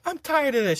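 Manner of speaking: high-pitched fed-up imitation; mock exasperated instructor. Instructor fed up with arguing recruits, mock exasperated.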